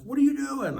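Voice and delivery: high-pitched sponsor's demand; imitating an exasperated, high-voiced challenge; acted confrontation. Sponsor bursts in, confronting him.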